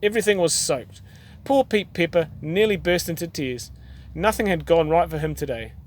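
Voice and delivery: usual speaking voice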